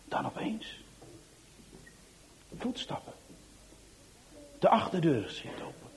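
hushed whisper of sudden danger; whispered suspense. A narrator's reading. Narrator building suspense for the listeners, whispered. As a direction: whisper it, something is coming.

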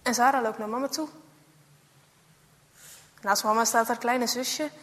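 plain reading voice

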